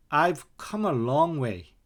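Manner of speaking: ordinary talk, nothing marked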